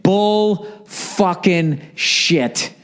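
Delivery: slow, incredulous; drawn-out disbelief. Real, not acted. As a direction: spell out each word in disbelief. Calls out the girlfriend's excuse.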